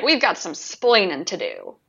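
ordinary delivery

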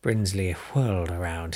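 usual speaking voice

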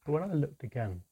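ordinary delivery, nothing marked

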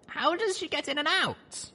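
high, curious falling question; curious young mare voice. Acted. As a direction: ask in a high wondering voice. Narrator voiced high, asks.

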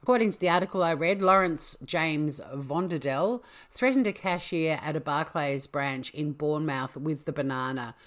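usual speaking voice

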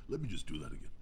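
normal talking voice

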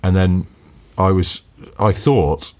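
ordinary talk, nothing marked

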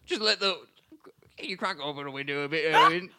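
quick, pleading Aussie bird voice; fast, wheedling Australian accent with a laugh; mock Australian. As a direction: bird begging to open the window, mock Aussie. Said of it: "He'd be like".

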